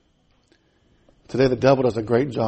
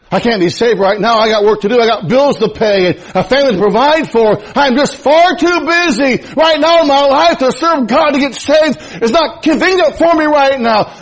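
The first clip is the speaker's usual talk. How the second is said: excuse-maker rising; 10 semitones higher, 6 dB louder than usual. Acted. Preacher voicing excuses to delay salvation.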